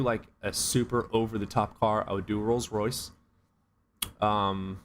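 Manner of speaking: normal talking voice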